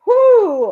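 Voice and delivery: high, loud startled whoo; pained whoo after hiccup. Real, not acted. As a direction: blow out the sting of a hiccup. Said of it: "I just had a painful hiccup".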